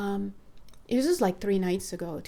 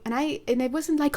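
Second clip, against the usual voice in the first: ordinary delivery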